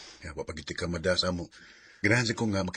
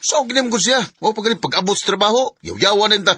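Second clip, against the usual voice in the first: much higher and louder, ranting; furious shout; 12 semitones higher, 11 dB louder than usual. Acted. Son shouting to his mother about his nagging wife. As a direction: yell it out, fed up.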